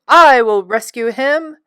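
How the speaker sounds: loud heroic shout. A narrator's reading. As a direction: bravely call out the rescue. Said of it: "he shouted".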